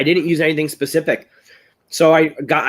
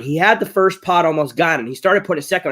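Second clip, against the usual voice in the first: ordinary delivery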